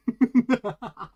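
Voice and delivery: a sudden high cackle that falls away; loud, high laugh that drops at the end; loud burst of laughter. Real, not acted. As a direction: laugh hard at your own dark joke. Big laugh at his own joke.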